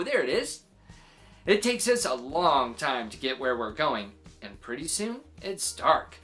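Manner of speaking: plain reading voice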